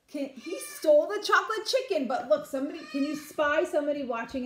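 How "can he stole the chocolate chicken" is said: ordinary delivery, nothing marked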